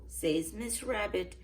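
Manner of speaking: ordinary delivery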